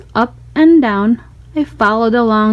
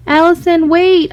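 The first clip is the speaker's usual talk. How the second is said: raised, higher call after a friend; 6 semitones higher than usual. A narrator's reading. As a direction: call across the room to Allison. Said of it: "I called".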